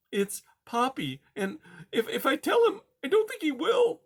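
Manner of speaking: tiny timid mouse voice, high; timid little mouse. Acted. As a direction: squeak it out like a scared mouse. Poppy speaking.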